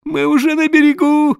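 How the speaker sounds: high wailing moan; mate moaning as he struggles free. A narrator's reading. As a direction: moan it in terror. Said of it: "стонал он".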